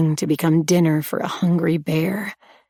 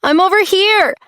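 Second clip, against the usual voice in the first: high, loud shout for help; 13 semitones higher, 6 dB louder than usual. A narrator's reading. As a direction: yell it out to the stranger. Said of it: "I shouted".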